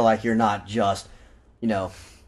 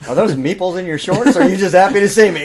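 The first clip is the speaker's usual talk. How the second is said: mock sultry; 7 semitones higher, 6 dB louder, faster than usual. Innuendo joke line.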